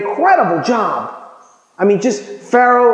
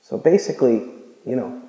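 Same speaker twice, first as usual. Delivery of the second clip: ordinary talk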